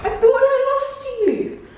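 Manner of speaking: slow, high, laughing coo to a dog; tender pet talk. Real, not acted. Talking to found dog.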